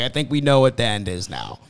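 normal talking voice

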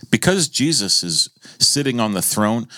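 normal talking voice

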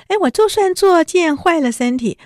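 高声尖起来的气话; 拔高嗓门赌气喊; 气愤尖叫. Retold or imitated. 黛玉气话. (尖声愤愤地说)

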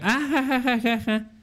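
high imitated laughter; put-on ha-ha laugh, pitched well up; mock laugh. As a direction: fake laughing in a retelling. Mimics a laugh in the story.

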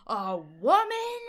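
slow, loud mock astonishment; incredulous disbelief. Podcaster mimicking Busey reading the computer screen, incredulous. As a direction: drag it out as a disbelieving question. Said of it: "in such incredulous disbelief".

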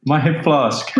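high-pitched comic lament; mock wail. Player mourning the stolen hip flask, high mock wail. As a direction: playfully cry out for a lost treasure.

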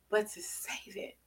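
hushed whisper of the verse. Acted. Preacher whispering scripture to the congregation. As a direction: breathe the words out softly.

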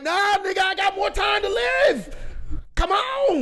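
high, slow defiant shouting spirit. Acted. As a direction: yell back at death, refusing to go. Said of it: "my spirit's gonna be like".

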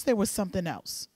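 ordinary talk

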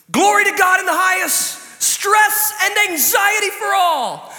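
hammed-up angel choir; mock angel proclamation. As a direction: announce it like heavenly angels. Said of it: "we should start singing that".

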